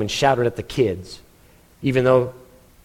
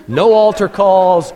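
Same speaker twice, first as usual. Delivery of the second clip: No altar calls, slow and louder; slow flat refusal; 4 semitones higher, 5 dB louder, slower than usual. Acted. Jonah telling the crowd what he won't do, slow and firm. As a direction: spell out the refusal word by word.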